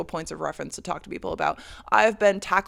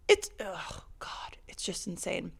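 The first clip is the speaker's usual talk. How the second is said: whispered disbelief, slow; exasperated whisper; 7 dB quieter, slower than usual. Real, not acted. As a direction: breathe it out in disbelief. Exasperated aside after the incident.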